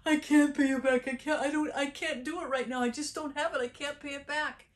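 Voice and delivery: high, loud acted begging; frantic pleading. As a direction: plead in a rush like a cornered debtor, voice up high. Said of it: "And the servant pleaded".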